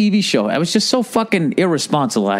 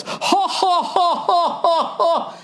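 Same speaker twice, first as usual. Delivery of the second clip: much higher, stiff fake ha-ha-ha laugh; mock Al Gore laugh; much slower than usual. Comic imitating Al Gore laughing at the debate, a high forced laugh. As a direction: ham up a forced head-thrown-back laugh. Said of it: "started laughing, just goes".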